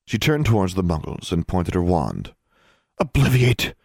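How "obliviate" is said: snapped spell. A narrator's reading. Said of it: "she snapped".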